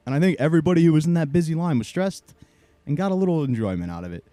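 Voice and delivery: ordinary delivery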